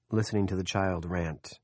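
ordinary delivery, nothing marked

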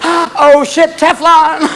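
high, drawn-out comic panic; panicked cat. Acted. Flung cat hitting the wall, mock panic. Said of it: "hit the wall and went".

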